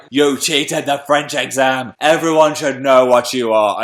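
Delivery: mock stern teacher, slow and loud; shaming a cheater in front of the class; stern French teacher. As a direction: scold him like the terrifying teacher. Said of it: "She was like".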